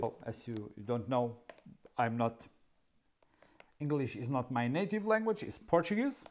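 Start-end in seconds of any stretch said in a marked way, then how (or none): none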